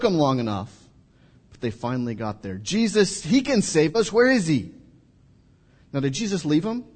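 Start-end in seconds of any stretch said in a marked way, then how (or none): 2.6-4.7 high, loud desperate plea for rescue; desperate cry. Acted. Disciples in the storm begging for Jesus, high and desperate. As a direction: cry out for the saviour in panic.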